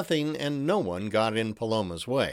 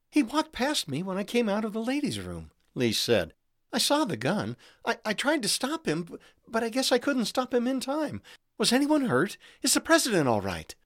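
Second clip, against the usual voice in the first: shaken; 9 semitones higher than usual. A narrator's reading. Shaken assistant after the shooting.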